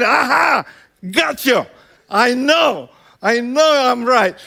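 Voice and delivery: mock "caught you" crowing; triumphant gotcha. Parent gloating at trapped child.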